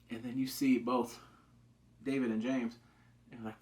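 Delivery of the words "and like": usual speaking voice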